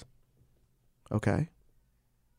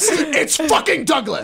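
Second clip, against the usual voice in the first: laughing correction; 14 semitones higher, slower than usual. Real, not acted. Laughing at the accident.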